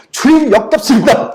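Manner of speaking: high, loud disgust to God; disgusted prayer. Retold or imitated. Pastor retelling his disgust at the TV ad, high and loud.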